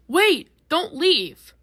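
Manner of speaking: high, loud call to stop; yelling out. A narrator's reading. R J Flag calling the others back before they leave, loud. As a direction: shout after them to wait. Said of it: "R J Flag yells out".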